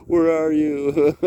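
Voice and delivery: slow high call into the woods. Retold or imitated. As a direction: call for someone lost. Kid calling out in the woods.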